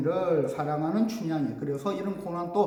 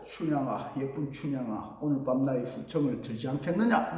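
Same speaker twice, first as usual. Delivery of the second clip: low, softer character voice; lightly voiced magistrate, pitched down; magistrate's proposition; 6 semitones lower, 5 dB quieter than usual. Acts out the wicked magistrate's proposition.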